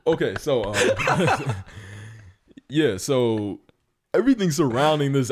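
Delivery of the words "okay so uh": laughing. Real, not acted. Laughing after [name] joke.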